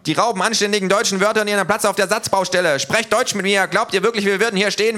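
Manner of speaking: high, loud and fast tirade; shrieking little thug, furious; ranting little thug. Acted. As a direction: rant like an outraged little bully.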